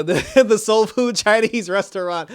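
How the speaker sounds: amused, laughing delivery. Real, not acted. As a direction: crack up while saying it, drawn out. Amused by own description.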